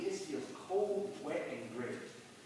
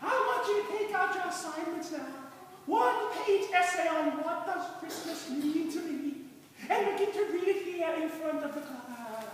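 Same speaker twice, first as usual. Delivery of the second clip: put-on trembling old nun; 11 semitones higher, 3 dB louder than usual. Old nun giving the class their assignment in a trembling voice. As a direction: quaver it like a hundred-year-old nun. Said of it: "says in a trembling voice".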